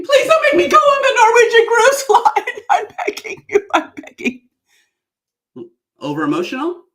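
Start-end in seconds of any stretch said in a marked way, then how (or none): 0.0-4.5 high, loud, laughing overacted plea; hammed-up begging from her speech opening; desperate begging. Shown as a demonstration. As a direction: beg melodramatically for mercy. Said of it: "It's the line where I'm begging".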